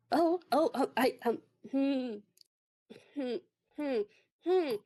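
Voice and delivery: high, broken crying hums; a hint of tearful stammering; mock sobbing. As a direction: whimper through the words, hammed-up. Peck sobbing, can't speak.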